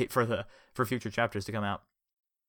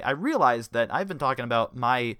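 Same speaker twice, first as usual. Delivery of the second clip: plain reading voice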